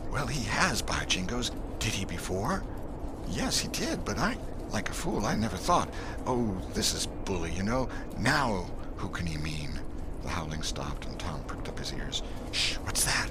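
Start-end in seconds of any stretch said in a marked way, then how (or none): 0.1-9.8 put-on higher country boy, relieved; light boyish relief, lightly voiced; lightly voiced kid breaking into glee; excited boy. Boys argue by the dog. 12.5-13.3 high alarmed whisper; startled boy whispering. Acted. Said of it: "he whispered".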